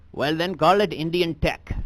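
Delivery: put-on comic thick Indian accent; stand-up bit done in a heavy Indian accent. Comedian on stage doing his act in an Indian accent. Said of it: "I gotta drop the accent".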